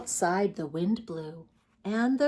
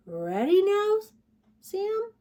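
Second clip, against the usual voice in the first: higher, sing-song mama voice; gentle mother bear; 12 semitones higher, 3 dB louder than usual. Acted. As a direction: coax the cub toward sleep. Mother bear asks again.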